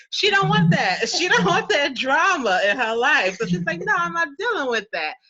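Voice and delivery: high, loud and emphatic. Real, not acted. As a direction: push it up high, she wants none of it. Voicing sister rejecting drama.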